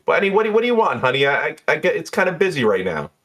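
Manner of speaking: high, fussy complaining voice; fussy bartender. Acted. Jeannie complaining to Dukes about the bottle breakers, high and fussy. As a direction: grumble about the rowdy fraggers.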